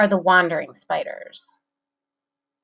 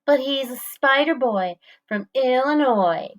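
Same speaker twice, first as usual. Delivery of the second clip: higher, smirking nerd voice; smirky nerdy voice; 6 semitones higher than usual. A narrator's reading. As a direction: play the smug nerd. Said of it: "in a smirky, nerdy voice".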